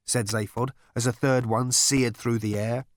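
ordinary delivery, nothing marked

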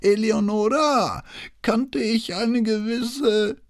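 doddering notary calling out slowly; old man calling. A narrator's reading. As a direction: call across the room in an old man's voice. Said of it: "rief der Frau in der Tür zu".

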